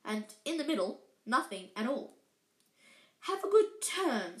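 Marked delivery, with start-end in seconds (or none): 3.2-4.4 slow, high, gloating sneer; spiteful glee. A narrator's reading. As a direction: savour the cruelty with a nasty smile. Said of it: "with an even nastier smile".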